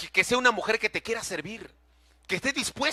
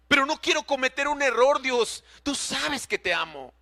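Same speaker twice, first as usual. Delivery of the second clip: tearful retold prayer; 5 semitones higher than usual. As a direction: beg God as if weeping, voice raised. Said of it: "Lloraba amargamente".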